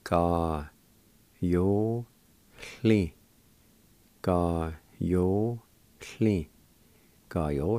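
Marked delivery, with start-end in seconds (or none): 0.0-3.1 Ka, yo, Hli as separate drawn-out syllables. Shown as a demonstration. Teacher showing learners how a word is pronounced, syllable by syllable. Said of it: "is pronounced like this". 4.2-6.5 Ga yo hli very slowly, each syllable held. Shown as a demonstration. Teacher repeating the word for meager, slow and broken up. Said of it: "is pronounced like this".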